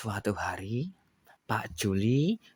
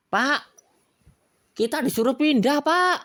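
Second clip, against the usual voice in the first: worried wife; 6 dB louder than usual. Acted. Wife delivering bad news.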